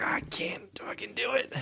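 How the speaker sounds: high, soft whisper. Retold or imitated. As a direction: breathe it out in a whisper. Retold own thought, whispered.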